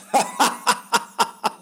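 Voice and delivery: a big high burst of laughter; loud high laugh. Real, not acted. Friend imagining laughing at her, a loud high laugh. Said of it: "I'd have been like".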